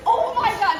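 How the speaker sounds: retold astonished exclamation; amazed. Woman watches friend dodge guards, high-pitched amazement.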